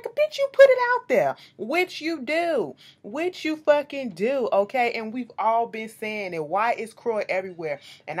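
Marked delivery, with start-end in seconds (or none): 0.0-1.4 very high, loud imitated comeback; mocking retort. Candy answering Kim's complaint, mocking retort. 1.6-4.5 "which you do" drawn out slowly and high; emphatic agreement. Real, not acted. Agreeing Kim put it out there, "do" drawn out emphatically. Driving home her agreement with retort.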